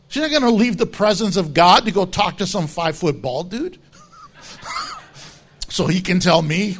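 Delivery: normal talking voice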